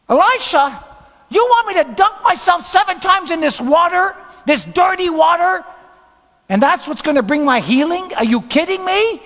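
high, loud, indignant shout; incredulous yelling, pitch way up; outraged disbelief. Acted. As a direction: shout your protest at the prophet. Acting out Naaman's protest to Elisha.

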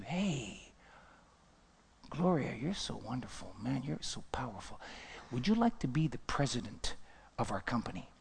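low, hushed, coaxing offer; soft flattery. Acted. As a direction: flatter someone confidentially, very softly. Acts out a flattering promotion offer.

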